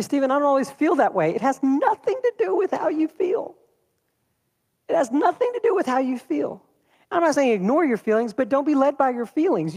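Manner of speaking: high, ringing preacher's exhortation; fervent preaching. Real, not acted. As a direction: drive the point home to the congregation. Preaching at full force.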